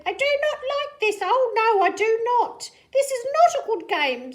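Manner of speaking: high, fast, loud fish complaint; fish character voice. Acted. Fish fallen in the pot complains, high and fast. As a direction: whine it out quickly. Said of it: "said our fish as he lit".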